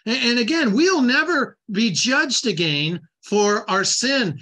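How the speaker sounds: usual speaking voice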